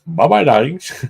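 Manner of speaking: high theatrical bye-bye; posh farewell. Pretend. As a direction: sing out a posh goodbye. Mock posh farewell to viewers.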